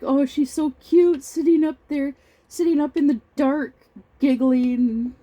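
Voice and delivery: high, loud mock gushing; delighted coo, pitched right up; parents gushing. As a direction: imitate parents cooing over their adorable baby. Parents gushing over drunk baby.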